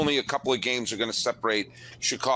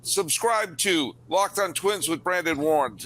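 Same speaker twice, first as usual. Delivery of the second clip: podcast host outro; 5 semitones higher than usual. Acted.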